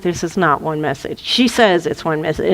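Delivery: low, quiet, unimpressed bouncer; deep-pitched doorman deadpan. Acted. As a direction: refuse to be fooled, voice dropped. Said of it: "And the bouncer's like".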